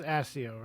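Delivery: Accio said with an S sound, ASS-ee-oh; soft C. Shown as a demonstration. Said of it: "he says".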